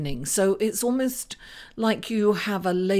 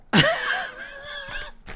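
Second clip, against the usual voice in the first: burst of laughter. Real, not acted. Laughs at her own quip.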